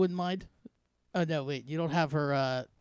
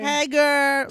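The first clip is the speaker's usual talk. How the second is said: put-on high, loud teen girl voice; valley girl voice; 9 semitones higher, 5 dB louder than usual. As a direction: chirp it like a bubbly teenage girl. Mocking Tay as a teenage girl.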